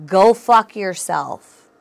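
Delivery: loud, slow, drawn-out mock blast; mock telling off. Host acts out rejecting a pushy person, loud and slow. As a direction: hurl it out slowly, hammed-up defiance.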